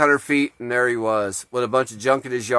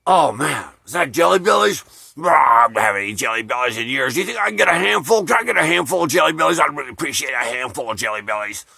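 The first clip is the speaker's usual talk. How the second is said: Papa Jimmy impression; faster than usual. Moocher begging candy from a lady, boozy rushed wheedle. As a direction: imitate a drunken moocher begging in a rush. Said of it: "try to do an imitation of Jimmy's voice".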